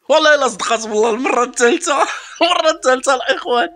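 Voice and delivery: very high, loud ecstatic laughing shout; overjoyed. Real, not acted. Pack opener yelling in joy at a third good pull, very high and loud. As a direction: scream with delight at the luck.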